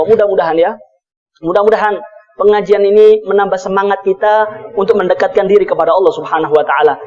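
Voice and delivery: low, even sermon benediction; closing prayer. Real, not acted.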